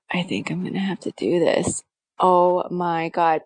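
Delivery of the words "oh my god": ordinary talk, nothing marked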